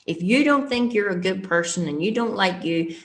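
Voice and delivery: usual speaking voice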